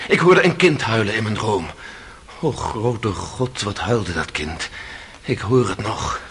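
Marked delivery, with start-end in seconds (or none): 2.4-6.2 low, very slow, soft anguish. Acted. Accused telling the court of the crying child, anguished. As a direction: let the grief pull the words down. Haunted dream recollection.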